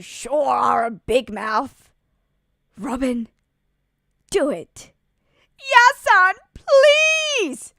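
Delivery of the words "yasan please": desperate plea. Acted. Begging for help.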